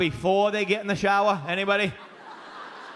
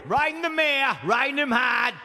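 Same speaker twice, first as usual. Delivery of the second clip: put-on cocky drunk kid; 5 semitones higher than usual. Drunk kid answering the manager, cocky and high. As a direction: brag like a drunk teenager.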